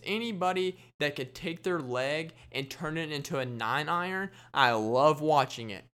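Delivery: normal talking voice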